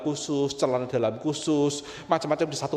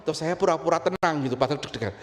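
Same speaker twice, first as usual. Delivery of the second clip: normal talking voice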